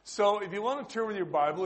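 ordinary talk, nothing marked

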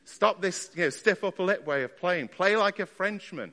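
piano teacher shouting from the other room; imitated shouting. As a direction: call out an exasperated order, loud and high. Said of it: "he would come shouting in".

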